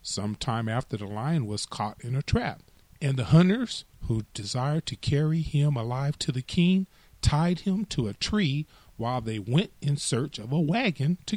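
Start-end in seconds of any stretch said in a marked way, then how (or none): none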